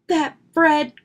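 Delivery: high, loud burst of temper; angry. A narrator's reading. Brother seeing Fred on his bike, angry. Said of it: "growled Brother".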